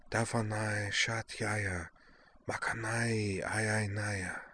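slow, soft words in an unknown tongue; gentle slow foreign murmuring to calm her lover; foreign soothing murmur. A narrator's reading. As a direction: murmur a foreign lullaby slowly. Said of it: "She'd lapsed into a language he couldn't understand, but it was so beautiful".

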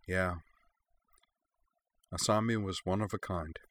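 soft, private murmur; hushed aside in a narrated story. A narrator's reading. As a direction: say it softly, as if to yourself. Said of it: "In a quieter voice, almost to herself".

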